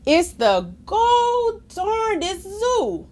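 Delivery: ordinary delivery, nothing marked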